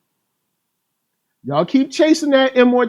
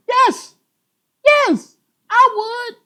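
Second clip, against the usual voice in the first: a playful falsetto agreeing with the critics; high squeaky yes; slower than usual. Pretend. Mock answer to the critics.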